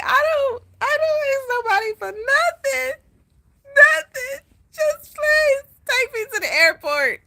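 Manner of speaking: very high, crying voice, begging; crybaby wailing. Retold or imitated. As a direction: wail like a big baby who gave up. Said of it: "I turned into the biggest baby ever".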